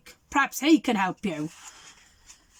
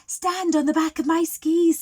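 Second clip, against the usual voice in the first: put-on higher girl's voice; bright helpful girl, pitched up; 7 semitones higher, 3 dB louder than usual. The girl offering Father Christmas a ride, pitched up.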